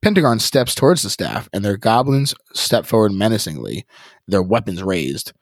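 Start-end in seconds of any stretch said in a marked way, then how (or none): none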